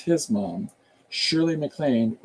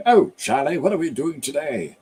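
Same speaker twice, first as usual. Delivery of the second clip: put-on Cary Grant-style continental accent, higher pitched; 4 semitones higher than usual. Imitating Cary Grant for the listeners, in a continental accent. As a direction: put on a made-up continental affectation. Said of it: "that continental accent, like, um".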